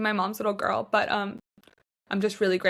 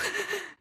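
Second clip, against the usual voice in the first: a sudden high burst of laughter; 12 semitones higher, 7 dB quieter than usual. Real, not acted. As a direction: laugh out through the tears, high. Laughing at her own tears.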